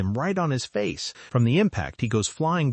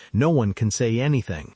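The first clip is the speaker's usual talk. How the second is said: usual speaking voice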